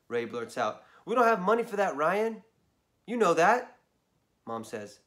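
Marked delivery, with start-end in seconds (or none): 1.0-3.8 louder, higher scolding mom; firm mom. Acted. Mom tells Ryan there's no money, firmly. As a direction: shut the kid down firmly.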